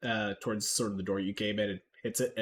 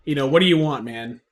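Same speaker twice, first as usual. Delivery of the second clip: raised, higher-pitched NPC rant; loud, higher grumpy shopkeeper voice; 6 semitones higher, 9 dB louder than usual. Acted. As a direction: bluster at the player like a grumpy dealer. GM voices ranting NPC Morris.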